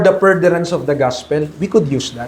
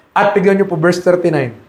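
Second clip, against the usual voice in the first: ordinary talk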